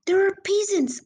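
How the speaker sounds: put-on high, excited boy's cry. A narrator's reading. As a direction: call out a thrilling discovery. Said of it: "I cried".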